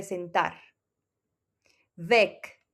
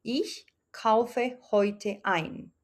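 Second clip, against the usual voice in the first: "Ich kaufe heute ein" said again even slower, lingering on Ich; a slower second take for the class to copy; slower repeat; much slower than usual. Shown as a demonstration. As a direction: repeat it very slowly, word by word, leaning on "ich". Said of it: "entonces la oración quedaría".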